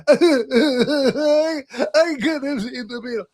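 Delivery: fake-weep the regret; mock crying breakthrough; mock sobbing. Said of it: "eventually you're like".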